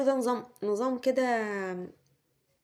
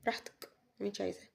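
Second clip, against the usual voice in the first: fast, much softer, offhand dismissal; dismissive brush-off; 3 semitones lower, 11 dB quieter, much faster than usual. Retold or imitated. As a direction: toss it off like you couldn't care less. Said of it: "يقول لك".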